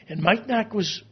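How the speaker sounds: ordinary talk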